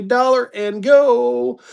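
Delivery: high, slow sing-out; drawn-out high sales call; auctioneer's opening call. Real, not acted. Auctioneer calling the opening bid.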